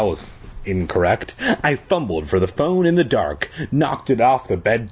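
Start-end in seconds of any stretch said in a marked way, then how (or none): none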